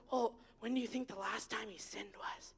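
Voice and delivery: whispered nervous question. Retold or imitated. The student whispering her worry to the speaker. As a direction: ask it in a scared whisper. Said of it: "and she goes".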